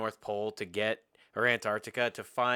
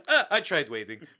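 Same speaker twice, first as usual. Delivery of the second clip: laughing; 3 semitones higher, 7 dB louder than usual. Retold or imitated. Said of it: "he's like".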